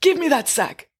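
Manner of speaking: high, forceful robber voice; ordering. A narrator's reading. Robber to man holding a sack, ordering. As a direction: command him to hand it over. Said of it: "ordered the robber".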